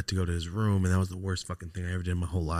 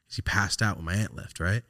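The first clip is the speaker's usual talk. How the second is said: normal talking voice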